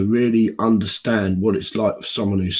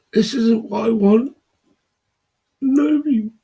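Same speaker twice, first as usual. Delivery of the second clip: real crying, voice breaking much higher; sobbing; 5 dB louder than usual. Real, not acted. Man telling viewers about depression, really sobbing with voice breaking high. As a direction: break down in tears, pitch cracking up high. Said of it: "here I am sobbing like a baby".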